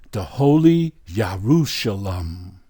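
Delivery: weighty preacher's reading; scripture proclamation. Real, not acted. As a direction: proclaim it emphatically, word by word. Preacher reads scripture aloud.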